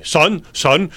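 a touch of a gruff chef calling. Retold or imitated. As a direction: call the boy over like a boss. Sous chef voiced in story.

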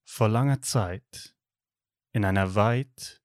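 slow, hushed fairy-tale opening; epic fantasy narrator. Acted. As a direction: intone it like a movie opening. Star Wars opening parody. (epischer Märchenerzähler)

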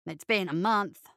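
low, dull mumble. A narrator's reading. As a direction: answer without any interest. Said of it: "Bo answered dully".

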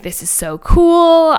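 high, drawn-out excited gush. Retold or imitated. Excited for her friend's chance, gushing and high. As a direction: stretch it out, thrilled and loud. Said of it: "so excited for her".

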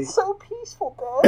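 high, soft, dying murmur; faint, dreamy. Pretend. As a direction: fade out like drifting off. Playing dead after laughing fit.

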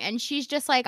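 ordinary talk, nothing marked

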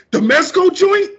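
high and loud, thrilled he knows it; excited recognition. Retold or imitated. Friend at the store recognizing the toy, excited. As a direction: burst out in delighted recognition.

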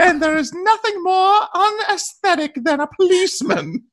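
high, precious Mickey Mouse voice; high squeaky voice. Pretend. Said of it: "Give it to us in your best voice".

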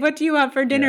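exasperated mock question. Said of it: "I've heard my friends say".

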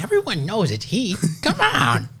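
high-pitched mock insistence; imitated pleading friend. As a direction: whine up high as if imitating him. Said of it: "He's all like".

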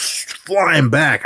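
slow, high stretch on the words; drawn-out emphasis. Retold or imitated. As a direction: stretch it like a body flying. Acts out the man's flight.

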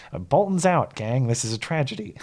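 lightly voiced fast-talking pundit, voice cracking; mock a pundit's dismay, with a crack on Bolton's; Ben Shapiro impression. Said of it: "an authentic Shapiro voice crack".

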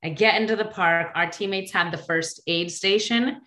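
usual speaking voice

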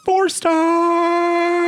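announcing. Acted. Announcing the review rating.

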